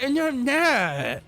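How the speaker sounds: put-on very high, slow whiny kid voice; whiny kid denial. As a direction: drag out a bratty denial. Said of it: "He's like".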